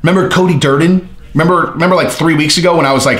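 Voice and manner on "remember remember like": ordinary talk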